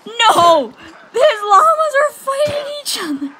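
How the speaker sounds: very high, loud crying shout; high squealing shout of alarm; shocked shout. Real, not acted. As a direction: yell it in disbelief. Llamas fight, player alarmed.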